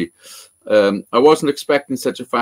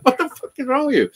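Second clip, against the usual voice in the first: incredulous; 13 semitones higher than usual. Retold or imitated. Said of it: "I'm like".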